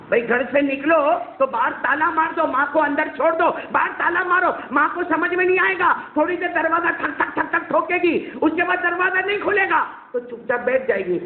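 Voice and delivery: high, excited scheming advice; high, gleeful student pitching a plan; eager student. Acted. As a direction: sell the clever idea with glee.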